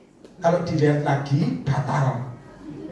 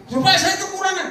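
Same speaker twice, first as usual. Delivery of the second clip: much higher, whiny puzzled voice; mock puzzled believer; 4 dB louder than usual. Preacher voices a doubting believer, high and whiny. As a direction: squeak it out like a baffled churchgoer.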